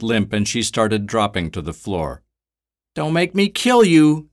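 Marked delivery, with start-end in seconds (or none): 2.9-4.3 high, loud shouted threat; furious threat. Acted. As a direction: yell it right in her face. Woman threatens the captive.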